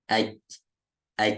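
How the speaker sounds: "Aj" stopped short with the sound cut off; closed jim sukun. Shown as a demonstration. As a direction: shut the tongue off on the j. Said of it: "Kayak jim gitu". ("Aj" ditutup rapat tanpa pantulan)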